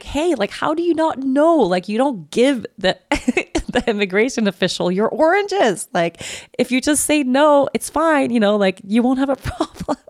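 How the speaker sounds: high, giggling teasing; laughing tease. Retold or imitated. Teasing Maya about the oranges, laughing. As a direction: tease her through laughter.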